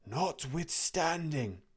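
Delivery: slow, high, hollow ghostly drawl. A narrator's reading. The dead partner's ghost answering Scrooge, slow and hollow. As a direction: intone it like a wailing spirit.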